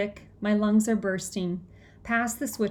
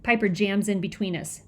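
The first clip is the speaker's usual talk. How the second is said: plain reading voice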